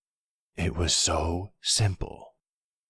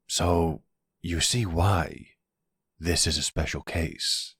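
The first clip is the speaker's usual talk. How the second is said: usual speaking voice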